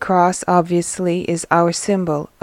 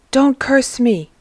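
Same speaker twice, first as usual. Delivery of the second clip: higher, louder plea; crying out; 5 semitones higher, 5 dB louder than usual. A narrator's reading. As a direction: cry out, begging to be spared. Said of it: "cries the man".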